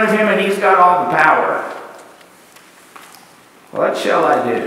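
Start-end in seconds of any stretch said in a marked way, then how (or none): 3.7-4.6 low, hushed wondering of a rich man; rich man's question. Retold or imitated. Rich man pondering his wealth, low and hushed. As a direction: ask it as the troubled rich man, lower. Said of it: "The rich man asked it".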